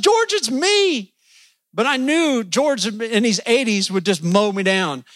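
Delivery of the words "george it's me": panicked call. Retold or imitated. Ducking from George's pistol.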